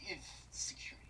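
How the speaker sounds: whispered sarcastic aside. Real, not acted. Reviewer sneering at the security guards in a whisper. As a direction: breathe it out under your breath.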